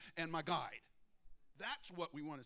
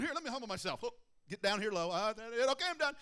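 higher, louder rushed going-through-the-motions; mock a quick show of humility and be done; mock hasty humility; 7 semitones higher, 10 dB louder, faster than usual. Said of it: "instead of going".

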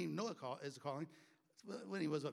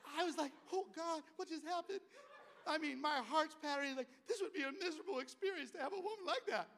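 high, laughing disbelief; real laughter recalling the shock; 13 semitones higher, 5 dB louder than usual. Real, not acted. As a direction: tell it through laughter. Laughing while retelling shock.

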